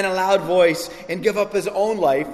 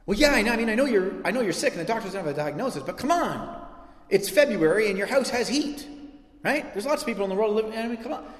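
higher, fast dismissive chatter, mocking; mock minimizing; 5 semitones higher than usual. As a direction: wave their suffering away, hammed-up.

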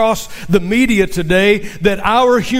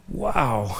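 ordinary talk, nothing marked

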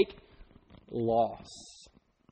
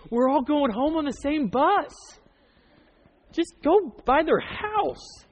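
retold inner thought; 7 semitones higher, slower than usual. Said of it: "I'm sitting there thinking".

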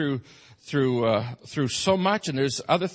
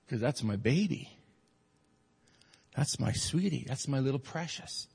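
much softer, lower, loving; tender fatherly; 4 semitones lower, 8 dB quieter than usual. Real, not acted. Father about his little girl, tender and soft.

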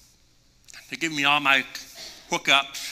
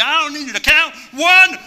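higher, louder, cutting off the count; eager shout; 9 semitones higher, 8 dB louder than usual. Retold or imitated. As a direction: yell it, impatient to jump.

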